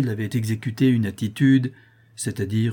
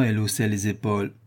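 usual speaking voice

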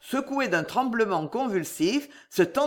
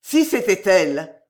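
terrible cry; 6 dB louder than usual. A narrator's reading. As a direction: cry out for a lost daughter. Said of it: "hurla-t-il dans un cri terrible".

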